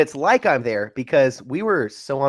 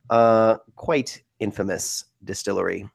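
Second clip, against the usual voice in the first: usual speaking voice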